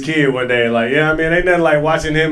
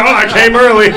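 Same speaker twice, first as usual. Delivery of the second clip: laughing mock quote; 5 semitones higher, 8 dB louder than usual. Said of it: "that's what he said to her".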